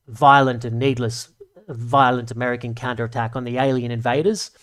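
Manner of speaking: lightly put-on, formal press-statement voice; mock government spokesman reassuring the public; a hint of a stiff politician; bad Australian Prime Minister impression. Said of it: "I'm fucking doing a bad impression of the Australian Prime Minister".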